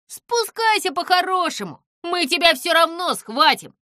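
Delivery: high, loud warning; loud, insistent ultimatum; called-up threat. Acted. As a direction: shout it up at someone on a tower. Crowd calls up a threat.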